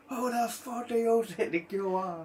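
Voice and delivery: high and slow, every word spat out in rage; slow furious shout. Retold or imitated. Traveller woman raging at the cheeky lad, slow high shout. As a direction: drag out the threat, high and furious. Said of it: "She went mad".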